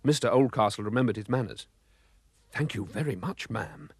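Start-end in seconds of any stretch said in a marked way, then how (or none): 2.5-3.9 softer, hesitant thanks; stammered. A narrator's reading. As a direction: thank her, shy and unsure. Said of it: "he stammered".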